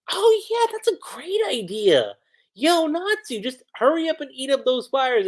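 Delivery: high-pitched cutesy girl imitation; Lucy, cutesy. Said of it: "sorta cutesy".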